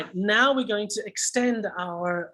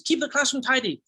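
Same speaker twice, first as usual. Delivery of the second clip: higher, firm teacher order; teacher's command; 6 semitones higher than usual. Shown as a demonstration. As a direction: give it as an order to the class. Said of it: "is something the teacher would say".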